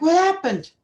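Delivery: high, loud alarmed cry of what happened; a mother rushing in, demanding to know what happened; retold alarmed question. Frightened mother bursting into the school office, high alarmed question.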